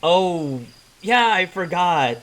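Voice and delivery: small high voice, sheepishly realizing; tiny forgetful character. Acted. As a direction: own up in a little high-pitched voice.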